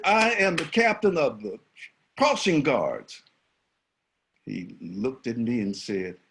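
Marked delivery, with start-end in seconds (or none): 0.0-3.2 high, loud claim of rank; proud declaration. Retold or imitated. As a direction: announce your title with pride. Guard asserts authority to bully.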